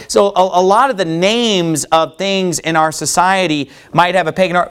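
usual speaking voice

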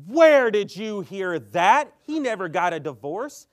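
loud, slow, indignant retort; angry demand. Retold or imitated. Pentecostal man defending his pastor, angrily. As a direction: bark it back, offended for his pastor. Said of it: "And he's like".

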